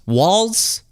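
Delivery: "Walz" with no T, swooping up high; corrected name pronunciation. Shown as a demonstration. Host answering a complaint, "Walz" without the T. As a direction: say "Walz" like walls, rising into a question. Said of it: "got mad at me for saying Waltz".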